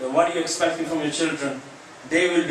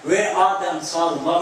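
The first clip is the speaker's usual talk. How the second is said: ordinary talk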